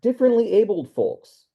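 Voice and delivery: high-pitched, somewhat sarcastic; facetious. Real, not acted. Offering a dubious term to co-hosts, facetious. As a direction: say it tongue in cheek, pitched well up. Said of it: "I say that as a somewhat sarcastically".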